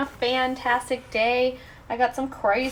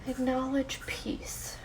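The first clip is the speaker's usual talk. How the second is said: soft, unhurried meditation voice; guided meditation; 6 dB quieter than usual. Real, not acted. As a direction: gently guide listeners into letting go.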